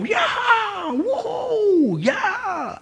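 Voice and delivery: high, drawn-out distant whoops; imitated cheering crowd. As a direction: whoop like onlookers heard from indoors. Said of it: "I hear people go".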